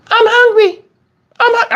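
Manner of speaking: put-on high, whiny little-girl voice. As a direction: play the hungry young daughter. Dad voices young daughter Tegan.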